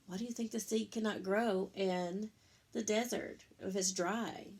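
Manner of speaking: ordinary delivery, nothing marked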